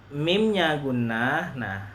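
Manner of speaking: normal talking voice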